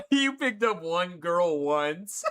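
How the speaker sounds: slow, drawn out through laughter; laughing. Real, not acted. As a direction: read it out while cracking up. Reading teasing message, cracking up.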